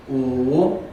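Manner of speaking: 乌 rising; second tone. Shown as a demonstration. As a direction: lift 乌 upward. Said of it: "第二个声调".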